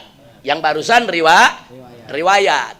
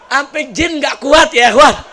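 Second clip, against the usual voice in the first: ordinary talk, nothing marked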